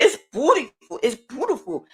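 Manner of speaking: imitated grandma, swooping pitch; grandma's manner of talking. Grandma praising the blanket she was shown, mimicked. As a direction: mimic a grandma cooing over a gift. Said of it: "That's how she talked".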